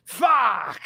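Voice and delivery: drawn-out gruff British curse; Roy Kent. Acted. Showing friends the approved impression, gruff swearing. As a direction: growl it like a British TV hardman. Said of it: "was a Roy Kent impression".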